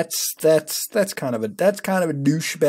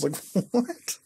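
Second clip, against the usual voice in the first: laughing what; 5 semitones higher, 5 dB quieter than usual. Real, not acted. Laughing at the book.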